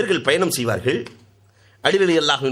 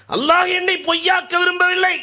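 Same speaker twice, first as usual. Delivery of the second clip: much higher, crying out through tears; tearful outcry; 3 dB louder than usual. A narrator's reading. Companion crying out to the Prophet, high and loud. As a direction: cry it out to the heavens. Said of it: "கண்ணீர் மல்க".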